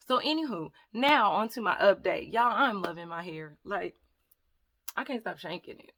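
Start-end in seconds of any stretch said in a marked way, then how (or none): none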